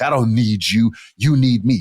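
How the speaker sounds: mild hammed-up arrogance; mock narcissist. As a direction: play the smug partner putting you down. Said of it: "they say a lot, like".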